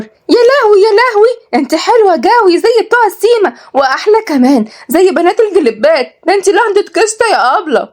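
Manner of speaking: put-on very high, gushing little girl; dazzled. A narrator's reading. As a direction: squeal your amazement at her beauty. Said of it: "قالت روحية بانبهار". (بانبهار، بصوت طفلة رفيع جدًا)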